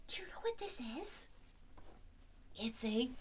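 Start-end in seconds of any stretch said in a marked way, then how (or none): 0.1-1.3 curious whisper. Acted. Mystery bag reveal.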